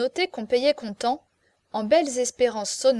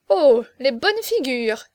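slow, louder mocking exclamation; amused mockery; 2 semitones higher, 6 dB louder, slower than usual. A narrator's reading. Young marquis eyeing the crowd, amused mockery. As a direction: draw it out, making fun of the faces. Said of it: "riait aux larmes et répétait".

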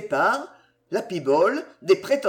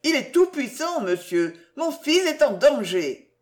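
pleading; 7 semitones higher than usual. Acted. Mother pleading for son.

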